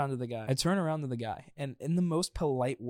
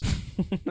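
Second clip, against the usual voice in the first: breathy laugh; 7 semitones higher, 3 dB louder than usual. Real, not acted. Laughs at own story.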